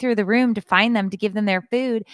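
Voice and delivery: loud, high, slowing at the end; imitated exasperated rant. Daughter venting about rude customers, loud exasperation.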